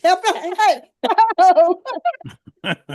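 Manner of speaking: laughing while asking it; bursting with laughter. Real, not acted. Laughing after [name] song joke.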